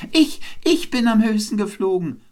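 very high, loud bird boast; jubilant calling. A narrator's reading. Wren returns to the other birds, calling jubilantly. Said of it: "Jubilierend kam der Zaunkönig".

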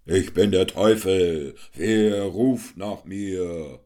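slow, menacing stage devil; devil announcing himself. A narrator's reading. The devil answers Hans Wurst, slow and menacing. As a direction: loom over them slowly.

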